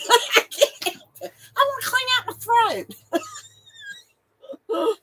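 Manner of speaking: high voice, words squeezed out through laughing; helpless laughter. Real, not acted. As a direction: barely get it out for laughing. Cracks up over own disgusting habit.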